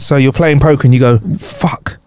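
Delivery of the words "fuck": soft, whispered expletive; hushed swearing. Acted. Poker player losing in a library, hushed swearing. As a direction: swear under your breath. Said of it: "Like that sound".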